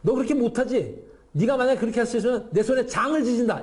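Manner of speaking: high, laughing taunt; hammed-up ridicule, pitched well up; mocking. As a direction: jeer playfully with amused confidence. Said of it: "이게 얼마나 조롱을 하는 말이에요".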